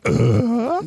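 Tim Allen grunt. Retold or imitated. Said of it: "He'd have to do it that way".

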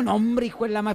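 ordinary talk, nothing marked

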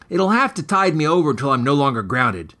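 ordinary delivery, nothing marked

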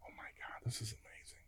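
breathless whispered awe; awed whisper. Retold or imitated. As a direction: whisper it, too stunned to speak. Stunned teen reacting to call.

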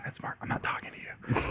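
soft whisper. Acted. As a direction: whisper it under the noise. Whispering while sneaking.

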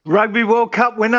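loud boast. Real, not acted. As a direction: trumpet your own title loudly, half joking. Joking self-announced title, loud.

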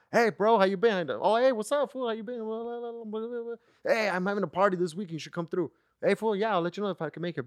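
high mock voices for both friends; acted text exchange. Friend invites him to a party, both voices pitched high and playful. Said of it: "one of my friends hit me up".

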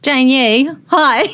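ordinary talk, nothing marked

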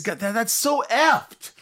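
high, drawn-out mock outburst; exaggerated high-pitched pretend cursing; mock exclamation. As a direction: exclaim it high and slow, hammed-up. Acts out softened swearing.

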